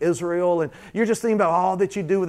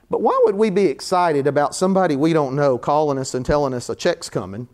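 ordinary talk, nothing marked

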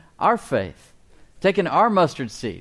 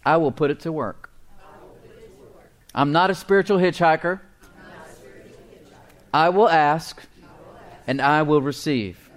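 emphatic phrases with long pauses for echoing; proclaimed confession of faith, deliberately phrased; leading group declaration; 3 semitones higher than usual. Shown as a demonstration. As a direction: lead the congregation line by line. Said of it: "Say this with me".

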